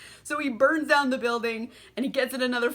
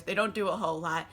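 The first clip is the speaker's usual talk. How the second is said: usual speaking voice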